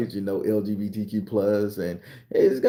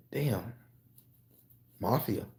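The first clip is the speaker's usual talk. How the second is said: ordinary talk, nothing marked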